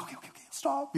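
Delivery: retold in a very high, soft voice; hurried soothing. Speaker recalls easing off while cleaning her hands, high soft reassurance. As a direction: back off quickly in a small high voice. Retelling reaction to girl's pain.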